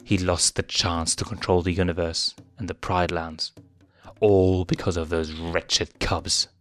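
ordinary delivery, nothing marked

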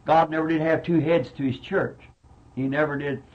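ordinary talk

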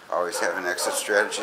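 usual speaking voice

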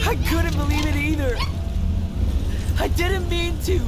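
high, loud, frantic apology; desperate pleading. Acted. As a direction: beg forgiveness at the top of your voice. Brother defends himself.

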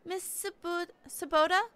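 high, questioning schoolgirl voice; schoolgirl class rep. Acted.

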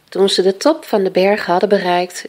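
usual speaking voice

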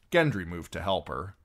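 ordinary delivery

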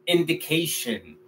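indication said softly, English A sound, ending shun; quiet model of indication. Shown as a demonstration. Teacher repeating English word for students, softly. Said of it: "se convierte en".

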